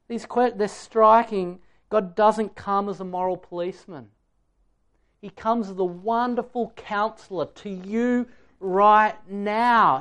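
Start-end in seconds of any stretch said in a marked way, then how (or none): none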